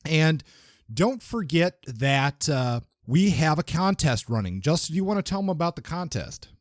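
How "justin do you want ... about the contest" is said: normal talking voice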